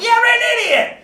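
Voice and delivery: high, loud angry yell; mock yell. Host acts the actor yelling in the scene, loud and high. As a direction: shout it at a fool. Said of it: "Timothy Busfield's like".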